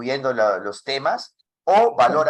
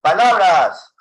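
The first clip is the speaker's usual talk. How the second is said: loud, higher-pitched projection; loud volume; 5 semitones higher, 9 dB louder, slower than usual. Shown as a demonstration. Teacher demonstrating volume to the class, loudly. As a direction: call the word out loudly. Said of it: "O puedo decir".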